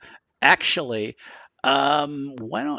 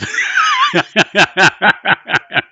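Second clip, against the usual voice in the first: high, loud, repeated cackling laugh; loud laughing fit; 14 semitones higher, 12 dB louder than usual. Real, not acted. Game master cracks up at the table, loud laugh. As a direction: burst out laughing helplessly.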